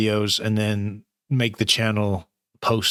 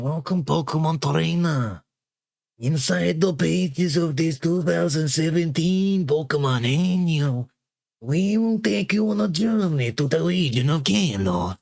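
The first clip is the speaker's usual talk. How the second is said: higher, slow storybook read; reading the annual aloud; 7 semitones higher, slower than usual. Acted. Reading the Pokemon annual to viewers, as a storybook host. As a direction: welcome the young trainer like a kids' show host. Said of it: "whatever accent I choose to do it in".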